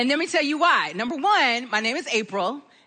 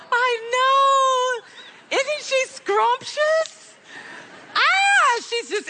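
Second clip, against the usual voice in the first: gushing delight; 11 semitones higher, much slower than usual. Real, not acted. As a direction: coo over her like a doting grandma. Gushing over granddaughter photo.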